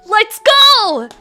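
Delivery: loud high hype cheer; excited cheer. Real, not acted. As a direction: shout it out to hype chat. Hype call to start.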